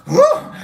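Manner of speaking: squeaky whew of relief; high relieved whew. Retold or imitated. Relief after the pit.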